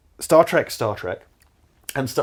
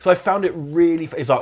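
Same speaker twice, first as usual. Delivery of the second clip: normal talking voice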